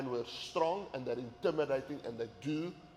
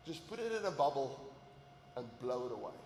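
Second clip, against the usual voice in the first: imitating wife; 6 dB quieter than usual. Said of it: "she'd say".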